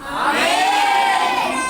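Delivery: a high, loud, long-held amen. Shown as a demonstration. Pastor models the strong amen he wants, loud and drawn out. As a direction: stretch the amen high and full. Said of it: "बोलो".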